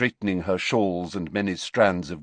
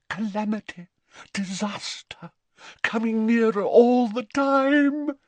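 slow, ominous prophecy, higher; dramatic; 4 dB louder than usual. A narrator's reading. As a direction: intone doom to Harry.

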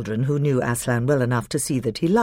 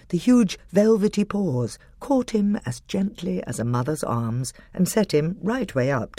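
usual speaking voice

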